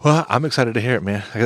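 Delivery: usual speaking voice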